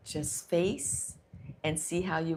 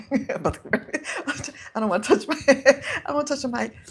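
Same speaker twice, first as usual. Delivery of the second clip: giggling through the words, higher and louder; laughing; 8 semitones higher, 5 dB louder than usual. Real, not acted. Speaker losing it over touching the mic, laughing. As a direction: break into helpless laughter mid-sentence.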